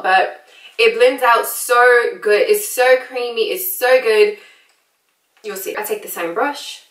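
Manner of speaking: put-on British accent, chatty makeup tutorial; American doing a casual British accent. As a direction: talk them through it in a playful British accent.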